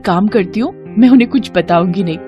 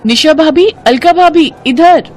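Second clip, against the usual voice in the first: high, loud call to catch attention; 8 semitones higher, 5 dB louder than usual. Acted. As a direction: shout after someone walking away. Meera calls to departing sisters-in-law. (ज़ोर से आवाज़ देकर पुकारना)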